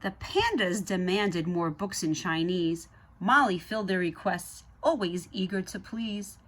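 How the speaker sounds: put-on lively read-aloud to children; story narration. A narrator's reading. Reading the Zoobrary book to kids, lively narration. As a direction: read it like a bedtime picture book.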